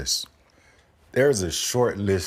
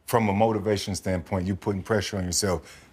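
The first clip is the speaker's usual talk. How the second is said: usual speaking voice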